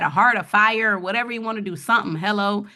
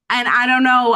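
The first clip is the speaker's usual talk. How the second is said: real emotion pushing the voice higher and louder; getting emotional; 4 semitones higher, 6 dB louder than usual. Real, not acted. Presenter moved by the wealth statistic, getting emotional. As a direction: struggle for words as feeling rises. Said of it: "I don't know why I'm getting emotional".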